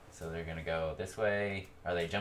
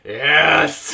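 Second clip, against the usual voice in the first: huge, high-pitched excited cheer; whooping battle-happy shout; excited yell; 8 semitones higher, 20 dB louder than usual. Acted. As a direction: yell it like a character thrilled to be fighting. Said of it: "He's so excited".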